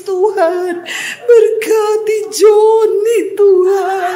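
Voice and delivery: high, loud, drawn-out fake wailing; hammed-up sobbing plea to God; mock tearful prayer. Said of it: "saya doa nangis".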